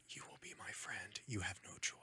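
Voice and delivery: very quiet, whispered pretend threat; hushed mock command; mock menacing whisper. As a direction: whisper it like a creepy ultimatum. Mock demand for friendship, acted.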